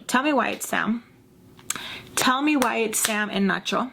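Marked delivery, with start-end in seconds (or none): none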